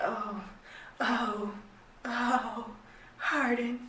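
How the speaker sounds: imitating her breathy moans of "Hardin", soft and drawn out; mock moaning name. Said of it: "I love it when you say my name".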